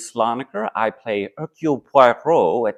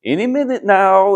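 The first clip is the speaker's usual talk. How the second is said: higher, louder mock-impatient nudge to hurry along; hammed-up hurry-up line, pitched up; impatient Tyler; 11 semitones higher, 6 dB louder than usual. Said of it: "Tyler say".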